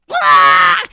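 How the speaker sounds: loud furious yell; angry scream. Acted. The imp screams in fury. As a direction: let out a rage yell.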